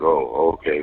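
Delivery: ordinary delivery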